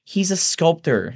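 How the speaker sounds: high-pitched, deliberate correction; terse. A narrator's reading. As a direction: state it curtly in a woman's voice. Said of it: "she says tersely".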